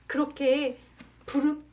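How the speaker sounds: ordinary delivery, nothing marked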